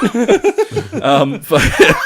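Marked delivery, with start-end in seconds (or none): 0.0-1.0 high squeaky laughing shout; mock outrage.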